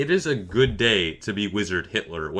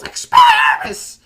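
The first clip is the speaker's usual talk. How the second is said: very high, much louder, slow shriek; shrieked spell; 11 dB louder, slower than usual. Retold or imitated. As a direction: scream the spell like a furious kid. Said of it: "he just yells".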